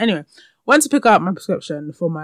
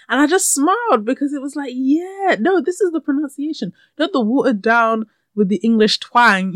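high, animated insistence; emphatic approval; 7 semitones higher, 5 dB louder than usual. Retold or imitated. Host insisting this is the pronunciation, fired up. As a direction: pitch it up and champion the proper way.

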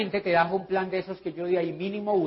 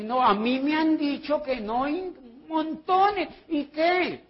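a higher, slow, mocking leader; 7 semitones higher, slower than usual. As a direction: imitate the complaining leader, drawn out. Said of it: "un líder que me dice". (un líder quejón, voz aguda y lenta)

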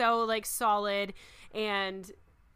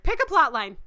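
loud, higher mock exasperation; exasperated call; 6 semitones higher, 11 dB louder than usual. Viewer retells scolding a crowded movie, exasperated shout. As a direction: call it out, fed up with the film.

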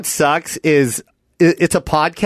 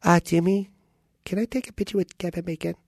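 soft, timid-sounding mom voice; mother; 2 semitones higher, 8 dB quieter than usual. Acted. Mom asking actor son for a photo, softly. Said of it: "Your mom wants to ask you one question".

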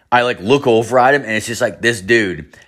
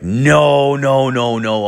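retold panic; 5 semitones higher, 3 dB louder, slower than usual. Fears he is passing out.

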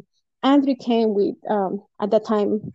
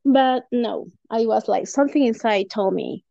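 usual speaking voice

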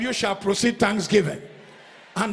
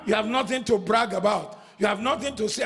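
normal talking voice

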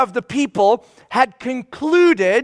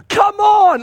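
exasperated Paul; 10 semitones higher, 5 dB louder than usual. Retold or imitated. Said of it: "He's like".

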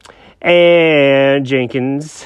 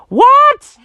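very high, loud shocked cry; shocked shriek; 6 dB louder than usual. Acted. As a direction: yelp in shock. Jenkins shocked.

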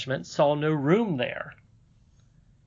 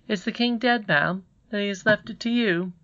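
put-on doubtful boy, higher voice; half in doubt; 8 semitones higher than usual. A narrator's reading. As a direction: ask politely, half believing it. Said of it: "half in doubt".

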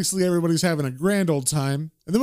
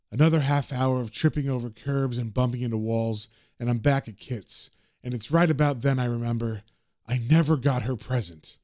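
low narrator voice; 6 semitones lower than usual. Acted. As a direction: tell it low and tired. Narration box read as John.

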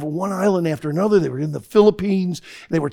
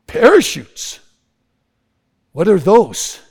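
baffled chief; 6 dB louder, slower than usual. Acted. Gurkha chief hearing of parachutes, loudly baffled. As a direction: blurt it out, totally puzzled.

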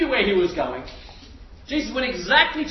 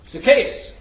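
calling out; 8 dB louder than usual. Acted. Jesus calls up into tree.

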